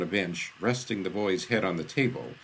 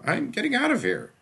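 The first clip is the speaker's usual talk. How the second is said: put-on a high, loud, stubborn boy; storming off in words; defiant exit; 4 dB louder than usual. Kid defies the stranger.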